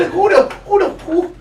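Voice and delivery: high, loud mock grandma outburst. Grandma cursing on the show, loud and high.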